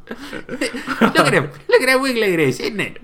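high-pitched, laughing mock British voice; crazy British accent. Friends riffing on the wiggly bits, in crazy British accent. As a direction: squeal it in a silly fake British accent. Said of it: "some crazy accent".

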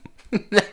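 high burst of laughter; real delighted laugh. Real, not acted. Man finding out he can play people's entries, high delighted laugh.

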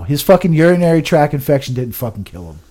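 usual speaking voice

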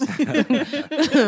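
a burst of high laughter; a real burst of laughter; loud high laugh. Real, not acted. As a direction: let out a big, loud laugh. Laughing at own joke.

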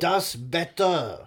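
slow, drawn-out mock Russian; fake Russian accent. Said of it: "a fake Russian accent".

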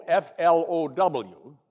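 F L O W spelled slowly letter by letter. Shown as a demonstration. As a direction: say F L O W as separate slow letters. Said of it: "called her Pastor Flo".

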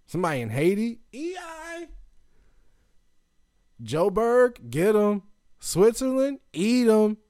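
high, loud hollering; yelling shout-outs. Real, not acted. Podcaster shouting out listeners around the world, high yell. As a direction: yell each place like a hype man. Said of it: "I'm just yelling".

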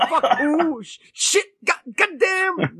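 high, strained comic cursing; Richard Pryor. Retold or imitated. Podcaster mimicking his pissed-off friend, high Pryor-style cursing. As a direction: stutter and wail the swear words, hammed-up. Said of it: "he sounded like Richard Pryor doing that".

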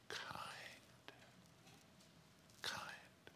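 whispered, letting the word land; hushed emphasis. Real, not acted. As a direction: drop to a whisper for the key word. Preacher stresses the key word.

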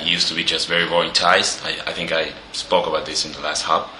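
ordinary delivery

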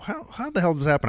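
high, quick, exasperated question; pissed-off disbelief. Retold or imitated. Man recalling his reaction to boss's story, incredulous. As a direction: retell your disbelief, pitched high and hurried. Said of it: "I was so fucking pissed".

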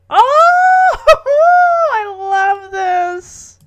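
high, loud squeal easing into a drawn-out gush; delighted squeal. Real, not acted. Host reacting to the baby daddy vow, delighted squeal. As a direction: squeal with real delight, then melt.

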